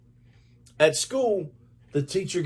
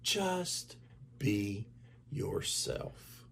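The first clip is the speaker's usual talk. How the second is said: hushed, tender mother's words; soft reassurance; 12 dB quieter, much slower than usual. A narrator's reading. Mother comforting Grover, soft and tender. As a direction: soothe him gently, almost a whisper. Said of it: "she said".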